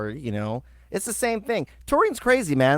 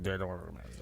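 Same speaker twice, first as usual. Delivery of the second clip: soft, low muttered refusal; hushed quote; 8 semitones lower, 17 dB quieter, much faster than usual. Retold or imitated. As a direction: say it low like a warning. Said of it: "They're like".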